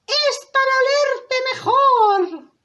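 high, slow fake-granny voice; wolf as grandmother. Wolf in disguise answering the girl, high drawn-out voice. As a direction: draw it out high, playing granny. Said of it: "como si fuera la abuelita".

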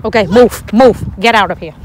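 loud, firm order to move. Real, not acted. Telling child to move away.

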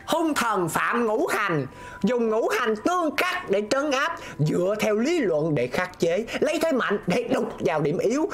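high, slow, measured recitation; high-pitched reciting of an ancient rule; clue read-aloud. Real, not acted. Reads out the puzzle clue.

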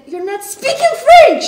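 high loud frustrated outburst; exasperated shout. Acted. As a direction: burst out in exasperation. Teacher finally explodes.

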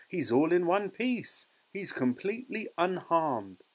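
high, triumphant announcement; jubilant cry. A narrator's reading. Wonka reassures parents the boy arrived whole, jubilant. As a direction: proclaim it with relief and delight. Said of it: "cried Mister Wonka".

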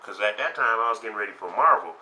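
ordinary talk

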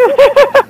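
high, loud laugh bursting out; burst of laughter. Real, not acted. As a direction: crack up suddenly. Bursts out laughing mid-sentence.

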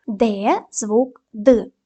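Д drawn out clearly, very slow; slow sound demo. Shown as a demonstration. Teacher models the sound Д for pupils. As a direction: sound out the letter Д slowly. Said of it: "дамо характеристику кожному звуку".

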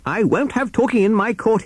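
high, strained, indignant judge; high-pitched snorting courtroom rebuke; snorting pig judge. A narrator's reading. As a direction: lay down the law with a piggy snort. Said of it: "he snorted".

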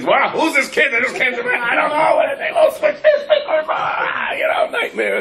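hammed-up frantic bus driver; panicked driver. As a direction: yelp in mock panic. Bus driver panics over switched stickers.

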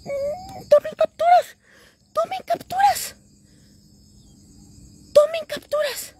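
very high, choked with tears; overwhelmed, high crying voice; tearful excitement. Real, not acted. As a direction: beg for screenshots through crying. Asks for screenshots while crying.

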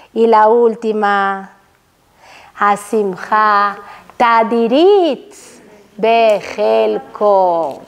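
low, very slow intoning; solemn recitation. Acted. Teacher announcing the last virtue, solemn and slow. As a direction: draw each word out like a blessing.

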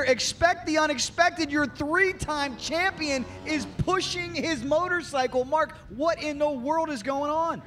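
rising excitement, high and urgent; breaking-news energy in the booth; excited play-by-play. Real, not acted. Live race play-by-play, breaking news.

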